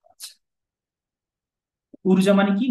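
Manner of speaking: ordinary delivery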